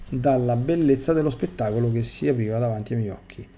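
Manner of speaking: ordinary talk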